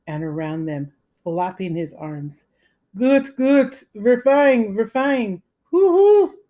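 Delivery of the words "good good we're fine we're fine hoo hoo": high crazed screeching voice. A narrator's reading. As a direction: screech it out like a madman. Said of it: "screeched Billy".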